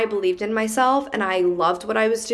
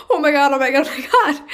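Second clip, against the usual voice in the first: high, loud, half laughing half crying; excited squeal; 6 semitones higher, 5 dB louder, faster than usual. Retold or imitated. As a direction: burst out in giddy disbelief. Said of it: "And I go".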